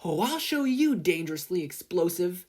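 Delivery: a hammed-up angry inventor; threatening. A narrator's reading.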